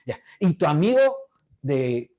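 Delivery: ordinary talk, nothing marked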